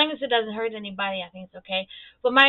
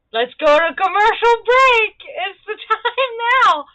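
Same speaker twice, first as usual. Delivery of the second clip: higher, louder and slow mock announcer; mock TV host; 10 semitones higher, 9 dB louder, slower than usual. Mocking the show's cut to a break, hammed-up announcer voice. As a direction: cut to commercial like a hammy TV host. Mocking a TV show cutting away.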